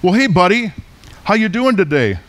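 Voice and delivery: a hint of talking-to-pets voice; Australian chatting to dog. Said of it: "the Australian says".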